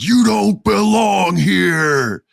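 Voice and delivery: high, drawn-out strained shout; loud wrestler's roar; Braun Strowman yell. Retold or imitated. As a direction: bellow it slowly at a rival. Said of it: "Braun Strowman beats his ass and yells".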